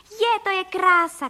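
high, loud, drawn-out cheer; shouting with delight. A narrator's reading. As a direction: cry out in childlike joy, slowly stretched. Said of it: "křičel Vilda". (nadšeně výskat)

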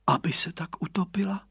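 hushed, fearful whisper; anxious thought. A narrator's reading. Radovan fears Kateřinka has drowned, anxious whisper. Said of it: "pomyslel si Radovan a při tom pomyšlení se mu sevřelo srdce".

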